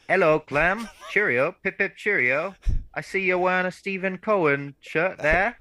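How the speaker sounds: high, hammed-up posh British accent; a jokey, over-the-top British accent; fake British accent. Host mocking his own bad British accent. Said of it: "couldn't even put on a fake accent".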